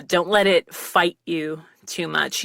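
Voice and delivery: ordinary talk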